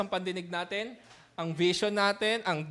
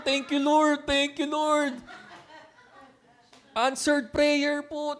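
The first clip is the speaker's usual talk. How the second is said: tearful thanks; 5 semitones higher, slower than usual. Retold or imitated. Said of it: "Umiyak ako".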